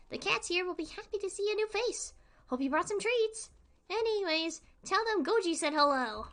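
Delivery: high, bright cat-guide voice; friendly game character. Acted. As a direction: pitch it up as the friendly Goji. Reads Goji's dialogue.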